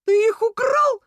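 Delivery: very high, loud shriek; squealing accusation. A narrator's reading. Rat accusing the rabbit of stealing, squealing. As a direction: shriek it in outrage. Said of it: "взвизгнул Крыс".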